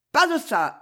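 understated shouted refusal; yelling. A narrator's reading. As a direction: bark it out without much force. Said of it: "hurla Famose".

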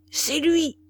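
high-pitched, sudden exclamation; cried out. A narrator's reading. Marquis spotting Gauvin through the embrasure, crying out. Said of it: "cria-t-il".

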